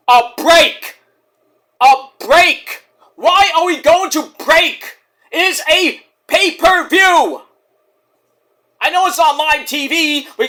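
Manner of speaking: high, loud indignant yelling; furious at the commercial break; outraged shout. Real, not acted. Fan ranting at the broadcast about ads, shouting.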